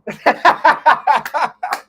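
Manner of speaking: loud, high cackling laugh; big laugh. Real, not acted. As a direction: burst out laughing in a long peal. Host laughs mid-agreement.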